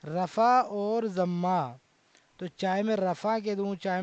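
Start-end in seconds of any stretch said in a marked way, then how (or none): 0.1-1.8 slow, deliberate naming. Shown as a demonstration. Teacher to students, naming the two terms very slowly. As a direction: say رفع اور ضمہ very slowly, word by word. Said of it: "پیش کے بھی دو نام ہیں".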